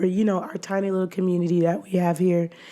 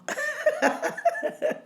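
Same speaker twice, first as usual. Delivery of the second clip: high giggle; 5 dB quieter than usual. Real, not acted. Laughs in greeting.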